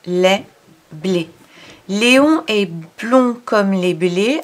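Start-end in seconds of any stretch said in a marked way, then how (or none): 1.9-4.4 put-on slow, syllable by syllable, for a child; slow reading aloud. Shown as a demonstration. Teacher reading the sentence slowly to a child. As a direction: read it out slowly and clearly. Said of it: "reading the sentence slowly".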